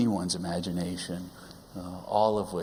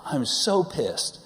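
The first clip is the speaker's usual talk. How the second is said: high, slow and louder furious quote; now the uncensored angry version, a bit louder; hammed-up fuming wife, louder; louder mock angry mom; 7 semitones higher, 3 dB louder, slower than usual. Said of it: "actually what she said was much worse".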